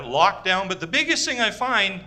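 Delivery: ordinary delivery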